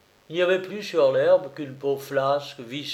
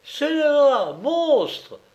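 much higher, louder furious roar; roaring insult; 13 semitones higher, 5 dB louder, much slower than usual. A narrator's reading. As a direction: bellow it at the culprit. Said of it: "rugit la grosse colombine".

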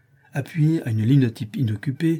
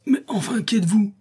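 high, breathless outburst; exclaiming; 10 semitones higher, 3 dB louder than usual. Acted. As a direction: cry out, beside yourself. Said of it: "s'écria le journaliste". (s'écrie, hors de lui, très aigu)

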